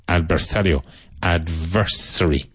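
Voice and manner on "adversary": Adversary with a strong, clear V, said slowly. Shown as a demonstration. Teacher to students, slow strong V in Adversary. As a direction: lean on the V in Adversary. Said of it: "Strong V in there".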